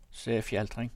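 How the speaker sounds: ordinary delivery, nothing marked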